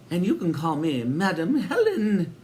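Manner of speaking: high, with a laugh; laughing introduction. A narrator's reading. Helen introducing herself to the boys with a laugh. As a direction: chuckle through the name.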